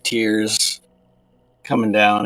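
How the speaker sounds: normal talking voice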